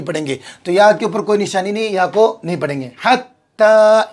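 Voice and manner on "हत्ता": हत्ता read slowly with a held doubled त; slow Quranic reading. Shown as a demonstration. Teacher reads हत्ता to students, slow and drawn. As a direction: linger on हत्ता, lean on the doubled t. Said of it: "इस तरीके से".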